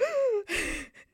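high, sharp gasp of shock; imitated gasp. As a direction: gasp like you just saw magic. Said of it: "We were like".

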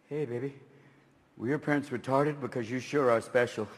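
put-on a drunk slurring a smooth line; sleazy pickup line. Drunk man chatting up a woman, low oily voice.

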